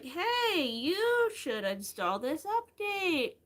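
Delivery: high, slow, sing-song pestering voice; mock the nagging tablet in a high sing-song. Mocking her tablet nagging her to update, sing-song. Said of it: "my tablet was like".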